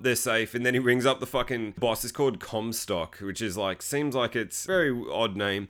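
usual speaking voice

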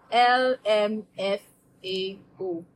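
ordinary talk

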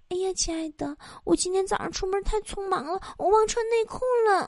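put-on soft, high girlish voice to a sweetheart; acted girl's hushed, high-pitched confession; girl confiding softly. Girl quietly confiding to her boyfriend on the bus, hushed girlish voice. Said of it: "听他们在小声聊天儿，女孩说".

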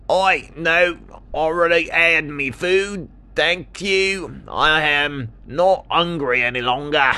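high, put-on kangaroo voice; high cartoon kangaroo; kangaroo, declining. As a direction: turn it down in a loud, high character voice.

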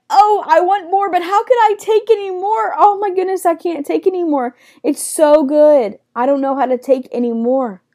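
high, loud gush easing down; overwhelmed delight. Acted. Believer overcome by delight in God, hammed-up high gushing. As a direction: cry out as if joy is too much. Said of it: "are you delighting in God".

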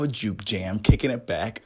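usual speaking voice

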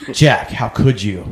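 slow mock betrayal; hammed-up wounded accusation; soap-opera dramatic. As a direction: draw it out like a soap actor before a cliffhanger. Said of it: "that long dramatic pause".

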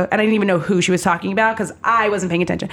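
ordinary delivery

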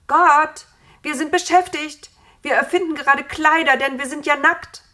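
high, loud shouted reply; calling back. A narrator's reading. Adam answering God from afar, calling back loudly. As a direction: shout back, pitched well up. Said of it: "Da rief Adam".

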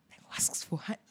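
hushed disbelieving aside; whisper. Real, not acted. Incredulous aside about honey.

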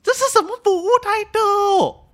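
high, loud outrage at the service; real outrage over bad customer service; indignant outcry. Real, not acted. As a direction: demand an answer, scandalised.

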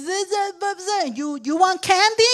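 put-on high babbling toddler voice; high toddler gibberish; toddler babble. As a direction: play the child who can't speak yet. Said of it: "They're going".

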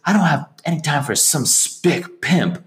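a lightly voiced scornful waitress; imitated waitress.

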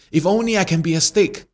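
high, plaintive dog wish; pleading dog. Acted. Beaten dog prays.

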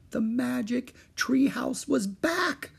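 high, slow, awed narration; wondrous reveal. A narrator's reading. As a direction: draw out the big reveal with wonder.

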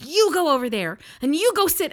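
high, loud mom command; bossy mom voice. Retold or imitated. Woman ordering grown brothers into timeout, loud mom voice. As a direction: send them off to timeout. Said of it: "some sort of a mom voice".

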